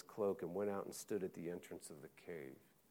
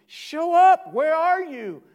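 high, loud mock demand; demanding shout; 21 dB louder, slower than usual. Preacher voicing a crowd demanding God, loud shout. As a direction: yell it up at the sky like an impatient crowd.

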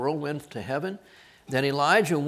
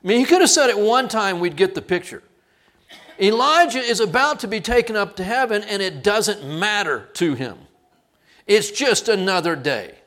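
usual speaking voice

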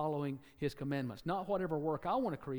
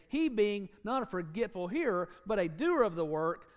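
preaching scripture; 5 semitones higher, 4 dB louder than usual. Acted. Preacher proclaims James to his congregation, ringing read. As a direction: proclaim the verse with pulpit force.

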